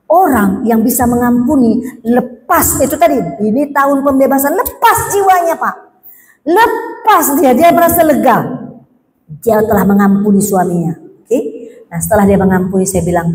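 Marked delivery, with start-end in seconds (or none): none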